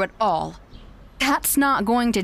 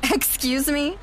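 high indignant question; offended excuse me; 6 semitones higher, slower than usual. Acted. Fiancée accused of cheating, high indignant excuse me. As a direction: take real offence at it.

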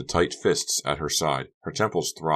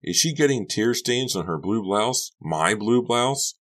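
raised, incredulous indignation; bitter thought; 6 semitones higher, 3 dB louder, slower than usual. A narrator's reading. Becca watching Honey in her blouse, bitter indignation. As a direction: lean on the questions with resentment. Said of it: "Becca thought bitterly".

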